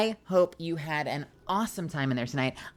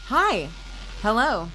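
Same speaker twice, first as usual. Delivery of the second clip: excited greeting; 6 semitones higher, 8 dB louder, slower than usual. Real, not acted. Greeting raiders.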